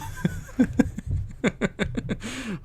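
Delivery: high burst of laughter; a high giggle at a silly word; real startled laugh. Real, not acted. Reacting to vomitory.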